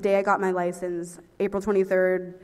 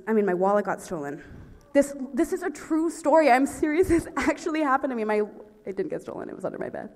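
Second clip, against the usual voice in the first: ordinary talk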